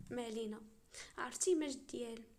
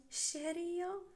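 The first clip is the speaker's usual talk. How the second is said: high questioning lift; incredulous echo; 7 semitones higher, 3 dB louder, slower than usual. Acted. Sheikh echoing her offer about the allowance, incredulous. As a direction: toss it back as an amused, disbelieving question.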